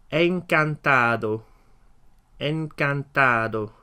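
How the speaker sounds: "Encantado" slow, every syllable clear; teacher modelling the word slowly; slow model. Shown as a demonstration. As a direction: draw out "Encantado" for learners. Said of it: "as we say the words in Spanish".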